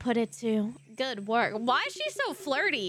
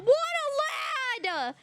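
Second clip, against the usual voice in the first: gushing; 12 semitones higher, much slower than usual. Real, not acted. Gushing at postman.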